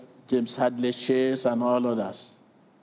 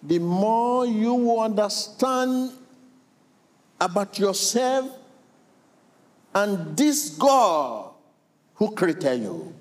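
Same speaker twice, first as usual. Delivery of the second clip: slow emphatic preaching; 6 semitones higher, slower than usual. Acted. Preacher drawing out key truth.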